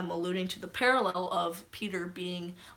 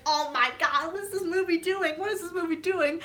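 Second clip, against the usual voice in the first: high, hammed-up distraught wail; imitated bawling; 9 semitones higher, 3 dB louder than usual. Retelling her theater meltdown at the movie, distraught. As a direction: whine it in mock despair. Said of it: "bawling my eyes out".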